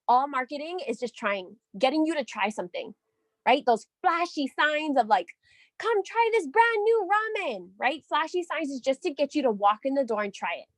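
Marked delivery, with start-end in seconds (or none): 5.8-7.7 mock ad voice. Voicing a flashy ad sign.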